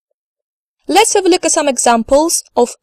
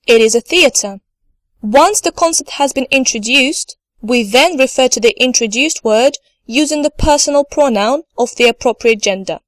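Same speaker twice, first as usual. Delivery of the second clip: ordinary talk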